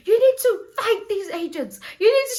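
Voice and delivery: high, loud mock outrage; angry friend. Friend telling her to fight the agents, hammed-up anger. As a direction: imitate a friend urging a fight, loud and worked up. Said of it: "she was so angry".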